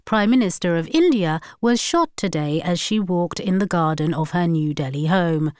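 usual speaking voice

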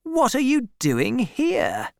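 high, startled question; taken aback by a visitor; surprised. A narrator's reading. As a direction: ask in astonishment, pitch jumping up. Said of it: "said Troy in surprise".

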